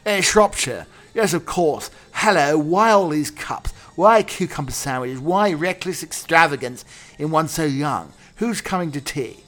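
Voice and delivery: reading Jack's lines from Earnest in a posh stage voice; Jack acting. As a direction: play Jack, affected and arch. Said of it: "Jack, says to Algernon".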